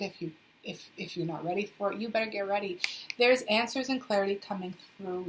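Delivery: ordinary talk, nothing marked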